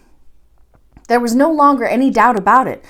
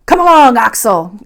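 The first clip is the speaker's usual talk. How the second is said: a flustered professor shouting across the path; impatient shout; 7 dB louder than usual. A narrator's reading. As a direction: bellow at the nephew to hurry. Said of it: "exclaimed my uncle".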